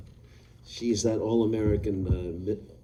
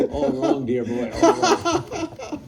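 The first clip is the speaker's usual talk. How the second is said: put-on much higher, slow, theatrical old-actor put-down while laughing; Paul's haughty verdict; 6 semitones higher, 6 dB louder, much slower than usual. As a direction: drag out all wrong like a grand thespian. Quoting Paul's verdict on accent.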